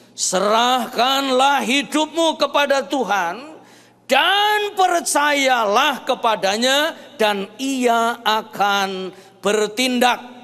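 forceful scripture reading, building to drawn-out phrases; preaching scripture. Acted. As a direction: proclaim each verse to the whole congregation. Declaims psalm to congregation.

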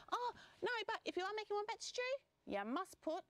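high-pitched lizard character; decline, then advise in a small high voice; high, insistent little animal; advising lizard. Acted.

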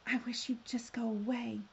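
mildly hissed complaint; understated storybook hiss. Acted. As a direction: hiss it into the dark, lightly acted. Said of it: "she hissed into the darkness".

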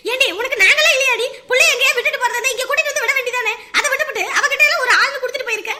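loud, heated tirade; angry scolding. Acted. Scolding her for trusting that old woman, furious. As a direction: scold hard, ending in a threat. Relative scolds, threatens the attacker.

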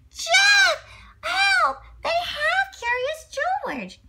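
very high, loud shout for help; high monkey cry for help. Acted. George yelling for Jeff when he's taken. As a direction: squeal for Jeff like a panicked little monkey.

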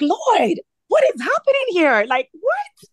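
very high, incredulous cry to heaven; bewildered plea. Retold or imitated. Distraught wife questioning the Lord, high bewildered plea. Re-enacted desperate plea while driving.

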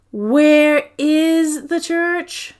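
loud, slow, high demand. A narrator's reading. Girl demanding her mother answer, loud and slow. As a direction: drag it out loudly, insisting. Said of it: "I asked loudly".

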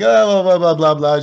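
high, loud mock blah blah; mock caller babble. Customer babbling, mocked.

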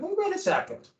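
high, puzzled colonist asking a question; skeptical colonist. Retold or imitated. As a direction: raise a doubt as a poor colonist. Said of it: "and not going like".

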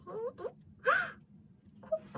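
high gasp, then delighted cry of the name; surprised squeal. Real, not acted. Pulls a favourite character card.

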